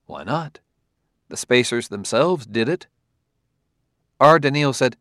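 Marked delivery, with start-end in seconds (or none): none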